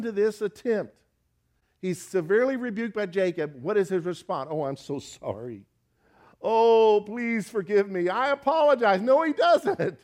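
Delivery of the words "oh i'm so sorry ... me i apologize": hammed-up contrition, rising higher; mock apology. Preacher mocking the apology Laban never gave. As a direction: play a sorry apologizer for laughs.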